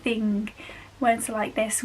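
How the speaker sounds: ordinary delivery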